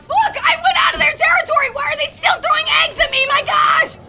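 high, fed-up outburst; exasperated protest. Real, not acted. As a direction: complain at your attackers, pitched high. Protesting at being pelted with eggs.

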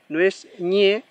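ñe with palatal ñ as in Spanish; wrong ñ sound. Shown as a demonstration. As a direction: show ñe said like Spanish ñ. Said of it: "Hay personas que lo pronuncian como ñ".

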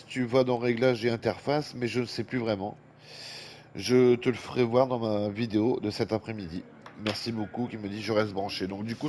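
usual speaking voice

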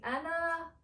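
usual speaking voice

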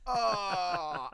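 high, drawn-out oh; mock guilty little wail; sheepish realisation. Sheepish reaction, retold.

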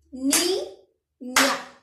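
Niña clapped out as ni-ña, loud and slow; clapped syllables. Shown as a demonstration. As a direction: separa Niña en sílabas con dos palmadas. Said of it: "¿Cuántas palmadas he dado?". (Niña silabeada en voz alta con palmadas)

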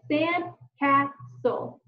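slow "Sandcastle" in three beats, sand, cas, tle; "Sandcastle" tapped out syllable by syllable; split into syllables. Shown as a demonstration. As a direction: pause after each syllable of "Sandcastle". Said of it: "thinking about the syllables".

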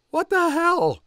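very high, far-off disbelief; high shocked exclamation; shocked recruits. Acted. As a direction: gasp it out in disbelief.

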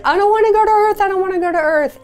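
high, loud pleading, retold; half-acted fearful refusal; reluctant plea. Telling the therapist she refuses Earth, high and pleading. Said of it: "And I said".

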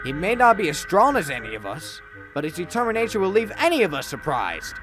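put-on lifted, earnest young engine. A narrator's reading. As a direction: stand up for old Edward.

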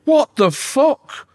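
slow, high, drawn-out disbelief; stunned what the fuck. Retold or imitated. Remembering seeing the documentary end, drawn-out shocked disbelief. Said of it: "and I was like".

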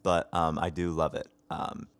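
normal talking voice

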